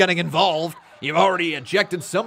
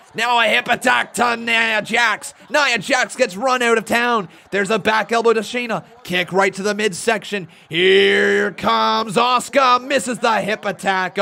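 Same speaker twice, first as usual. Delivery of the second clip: excited, louder, higher ringside calls; live play-by-play; 6 semitones higher, 8 dB louder, slower than usual. Acted. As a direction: call the match as it happens.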